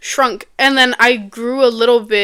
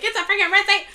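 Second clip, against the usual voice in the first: pitched-up mock remark; acted quote; 8 semitones higher, 4 dB quieter than usual. Co-host mimicking herself blurting about the state, pitched-up quote. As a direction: say it high, as if quoting yourself. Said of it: "I'm over here like".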